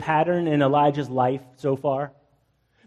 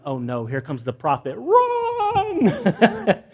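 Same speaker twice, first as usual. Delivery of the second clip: mock panic; 4 semitones higher, much slower than usual. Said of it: "they would be like".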